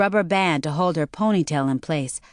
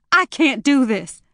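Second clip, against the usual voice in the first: panicked; 7 semitones higher, 4 dB louder than usual. Acted. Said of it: "almost hyperventilating".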